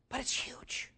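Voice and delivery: breathy, unvoiced "But it's huge"; hushed whisper. Real, not acted. Pastor telling the congregation, whispered for emphasis. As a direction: drop to a whisper for weight.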